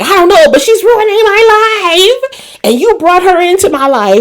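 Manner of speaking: high, whining complaint; whiny high Bobby. Retold or imitated. Bobby whines to Niles on the phone, high and complaining. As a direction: pitch it up and whine.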